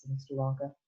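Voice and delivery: ordinary delivery, nothing marked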